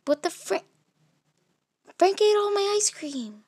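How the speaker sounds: high, loud furious discovery; loud indignant wail; outraged shriek. Acted. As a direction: shriek it, betrayed over ice cream. Discovers ice cream eaten.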